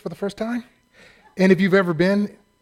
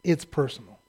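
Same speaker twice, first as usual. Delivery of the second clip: soft solemn; 5 semitones lower, 8 dB quieter than usual. Retold or imitated. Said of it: "he says".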